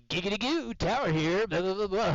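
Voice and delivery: high, loud giggity over the radio; the instructor as Quagmire calling tower, high and loud. Retold or imitated. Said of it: "and he'd be like".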